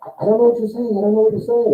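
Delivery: high-pitched, helpless protest; mock-helpless recruit, high and insistent; bewildered recruit. Bewildered recruit yelled at in French.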